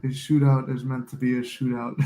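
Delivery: normal talking voice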